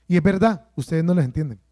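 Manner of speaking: usual speaking voice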